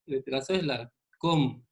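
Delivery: normal talking voice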